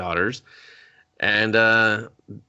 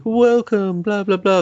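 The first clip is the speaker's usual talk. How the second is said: high, mock British welcome; hammed-up high British accent; fake British accent; 11 semitones higher, 5 dB louder than usual. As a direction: greet in a badly faked British accent. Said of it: "doing fake British accents".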